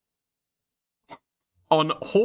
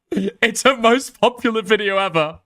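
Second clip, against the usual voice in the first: ordinary delivery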